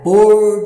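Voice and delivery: "pôr" loud and drawn out, closed "oh". Shown as a demonstration. Teacher reading the sentence for pupils, forceful word. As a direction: lean long and hard on "pôr". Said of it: "coloca mais força na palavra pôr".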